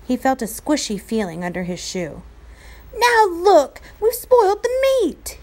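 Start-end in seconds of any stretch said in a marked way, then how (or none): 3.0-5.4 high, loud angry shout. A narrator's reading. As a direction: yell it at him. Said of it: "he shouted at Patrick".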